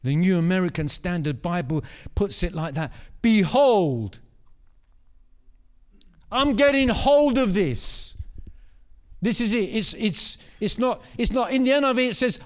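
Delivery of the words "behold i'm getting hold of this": 1 proclaiming loudly. Acted. Preacher voicing Mary's declaration.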